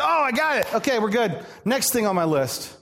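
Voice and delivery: light, dismissive, done with it; a hint of a smug believer ticking a box; mock breezy believer. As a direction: brush it off, voice up on oh. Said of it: "this is not just a one-time thing".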